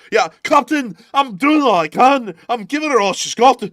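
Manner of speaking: high, loud hammed-up Scottish brogue; Scottish accent. Shown as a demonstration. Engineer to captain under strain, shouted Scottish accent. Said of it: "You have to know your accents".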